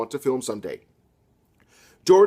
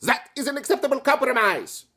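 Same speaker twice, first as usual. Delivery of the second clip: put-on higher stiff German accent; 10 semitones higher than usual. Fascist soldier slipping into a mock German accent. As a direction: switch into a mock German officer. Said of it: "did your accent just become German".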